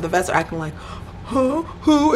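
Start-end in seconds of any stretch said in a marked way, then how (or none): 1.3-2.1 high-pitched mock-innocent questions; feigned cluelessness. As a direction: play dumb in a high voice. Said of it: "acting like they don't know what's going on".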